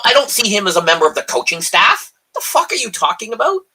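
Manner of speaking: ordinary talk, nothing marked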